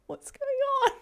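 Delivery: put-on high, soft, whining child's voice; a confused little boy just woken; sleepy baby voice. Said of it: "He's like".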